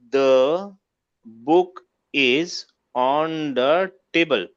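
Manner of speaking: slow, spaced-out model sentence; deliberate teacherly dictation; word by word. Shown as a demonstration. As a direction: pause after each word, spelling out the full answer. Models a full-sentence answer.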